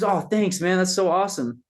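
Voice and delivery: high, delighted thanks; chirpy grateful guy; grateful clerk. Retold or imitated. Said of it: "He goes".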